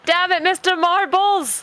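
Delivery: high, drawn-out playful scolding; slow, high mock lament; mock exasperation. Real, not acted. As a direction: curse the pet with amused frustration.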